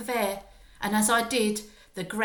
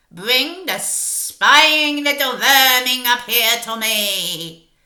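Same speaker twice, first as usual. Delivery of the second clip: loud, slow, strange witch voice; witch's shouted order; 3 semitones higher, 14 dB louder, slower than usual. Acted. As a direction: spit out vermin with contempt. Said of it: "shouted the Grand High Witch".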